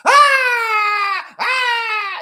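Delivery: put-on high piercing girl's scream; little girl screaming. A little girl in the alley screaming in terror. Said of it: "he hears a little girl screaming".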